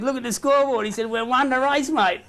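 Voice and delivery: gleeful. Retold or imitated. Player telling the umpire their horse won, high and gleeful. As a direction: crow it out high like you've just won big. Player gloating about the bet.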